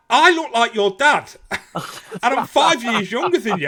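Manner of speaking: high, loud disbelieving exclamation; incredulous. Retold or imitated. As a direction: blurt it out in shock. Shocked he is forty-two.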